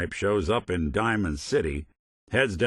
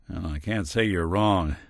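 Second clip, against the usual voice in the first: normal talking voice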